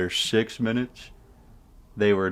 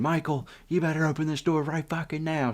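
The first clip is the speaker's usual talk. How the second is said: imitated screaming Karen; 8 semitones higher than usual. Karen demands the boys open up, mock screaming. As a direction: put on a high irate mom voice. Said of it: "the Karen was screaming".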